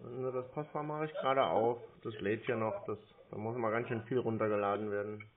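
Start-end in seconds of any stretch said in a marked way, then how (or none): none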